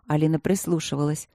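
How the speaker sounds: ordinary delivery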